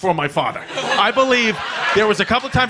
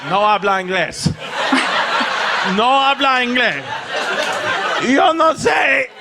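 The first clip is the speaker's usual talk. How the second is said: fake Spanish; 3 semitones higher than usual. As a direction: play dumb in a bad Spanish accent. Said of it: "And I was like".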